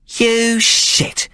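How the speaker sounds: high, contemptuous insult; a high voice full of disgust. A narrator's reading. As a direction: sneer down at the cowering man. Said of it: "she said, disgusted".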